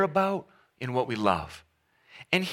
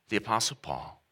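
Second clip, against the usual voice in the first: ordinary talk, nothing marked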